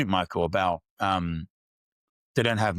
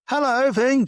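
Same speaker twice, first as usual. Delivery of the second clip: very high, loud squeaky piglet voice; playful piggy voice reading a bedtime story; Peppa Pig; 6 dB louder than usual. Retold or imitated. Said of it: "said Peppa Pig".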